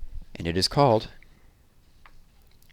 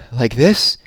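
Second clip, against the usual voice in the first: puffed; 3 semitones higher, 7 dB louder than usual. Acted. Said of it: "he puffed".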